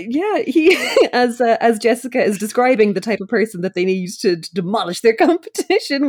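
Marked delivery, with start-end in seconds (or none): none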